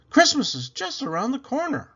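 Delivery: high, loud lady of the house calling; Mrs Rogers voice. Acted. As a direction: call her name up high like the lady of the house.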